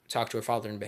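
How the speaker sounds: usual speaking voice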